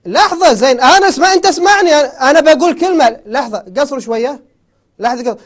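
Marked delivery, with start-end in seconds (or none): 0.1-3.2 loud and higher, forcing the floor; shouting to be heard. Real, not acted. The host shouts over the angry caller to get a word in. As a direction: talk over him, demanding he listen.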